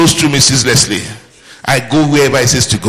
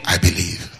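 calm I believe; 8 semitones lower, 8 dB quieter than usual. Shown as a demonstration. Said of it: "first say".

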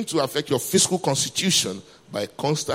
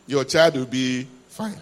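slow, deliberate reassurance; slower than usual. Real, not acted. Preacher reassuring overprotective parents, slow and deliberate "Your child will be fine". Repeated slowly to anxious parents.

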